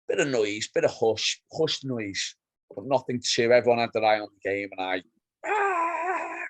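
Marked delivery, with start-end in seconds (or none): 5.5-6.4 high drawn-out "aah" cheer; high held celebratory cry; mad goal celebration yell. Retold or imitated. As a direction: re-enact going mad at a goal. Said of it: "going mad".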